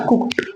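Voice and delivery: कुक्ड ending in T; correct pronunciation. Shown as a demonstration. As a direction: model कुक्ड with a T for the D. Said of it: "इस तरीके से बोलेंगे". (कुक्ड में 'ड' की जगह 'ट')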